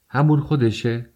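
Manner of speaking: high-pitched exclaimed question on همون خودشه; crying out. A narrator's reading. Raskolnikov challenges Svidrigailov's words, crying out. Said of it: "راسکولنیکوف فریاد زد".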